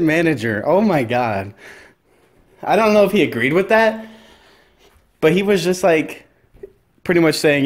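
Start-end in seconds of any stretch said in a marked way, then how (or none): none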